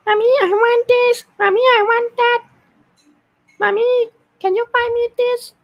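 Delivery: put-on very high nasal kid voice, spoken through the nose; whiny kid. Shown as a demonstration. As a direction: beg mommy like a small child. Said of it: "kid one is like".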